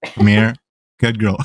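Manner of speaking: ordinary delivery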